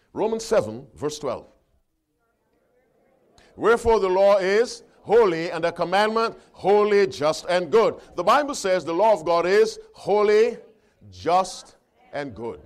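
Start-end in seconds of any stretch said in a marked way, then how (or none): 3.6-12.6 loud, raised scripture reading; ringing pulpit delivery; forceful preaching. Acted. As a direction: proclaim each quality of the law with weight. Reads scripture aloud to congregation.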